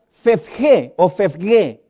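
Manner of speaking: "fefge o fefge" said slowly, syllable by syllable; "fefge" sounded out slowly and clearly; spelling out a Greek word. Shown as a demonstration. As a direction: carefully pronounce "fefge" twice, slowly. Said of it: "que se pronuncia".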